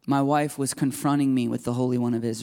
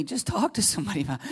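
gentle goading; 7 semitones higher, faster than usual. Retold or imitated. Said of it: "the Lord was goading me".

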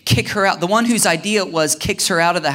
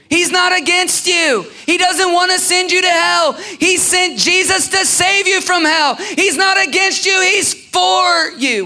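much higher, louder shouted preaching; 12 semitones higher, 5 dB louder than usual. Acted. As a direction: shout it out to the whole room.

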